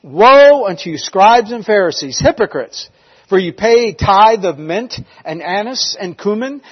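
loud, emphatic declamation; scriptural rebuke. Retold or imitated. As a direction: proclaim the rebuke with force.

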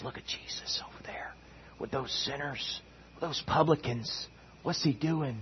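hushed muttering under the breath; whispered murmuring. Acted. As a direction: whisper it among yourselves, as disapproving onlookers. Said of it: "imagine these guys whispering to themselves".

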